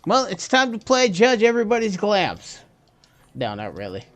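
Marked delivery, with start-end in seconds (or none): 0.1-2.6 mock announcer. Jokey game-show announcement.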